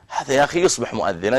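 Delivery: ordinary talk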